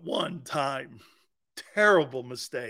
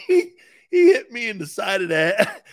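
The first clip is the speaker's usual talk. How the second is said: laughing; 6 semitones higher, 6 dB louder than usual. Real, not acted. Laughing while retelling.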